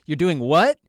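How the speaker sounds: baffled friend can't believe it; incredulous. Retold or imitated. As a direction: retell it as a stunned, rising question. Retold reaction to friend stripping.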